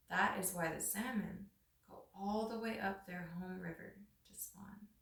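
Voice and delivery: steady storyteller reading a legend aloud; story narration. A narrator's reading. Reading a Pacific Northwest legend to listeners, calm storytelling. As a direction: tell it like an old legend to listeners.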